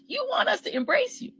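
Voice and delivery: high mocking sing-song. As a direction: squeak it out in scorn. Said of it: "repeated, pitch jumps very high".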